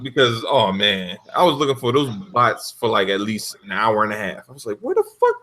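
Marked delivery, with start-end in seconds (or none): 4.8-5.4 mock outrage. Retold outburst, very high pitch.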